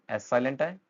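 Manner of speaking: normal talking voice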